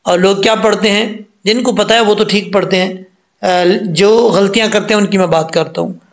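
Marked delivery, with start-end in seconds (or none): none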